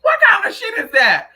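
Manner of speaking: loud, high outraged demand; a high, loud cry of indignation; outraged exclamation. Real, not acted. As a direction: blurt it out in disbelief. Outraged reaction to Biden quote.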